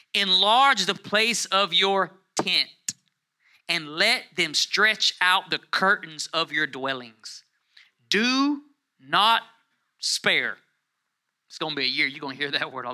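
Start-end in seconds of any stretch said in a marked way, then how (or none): 0.1-10.6 loud, high and drawn out, phrase by phrase; scripture proclamation. Acted. Preacher reading Isaiah to the congregation, forceful slow proclamation. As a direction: declare it slowly to the whole room.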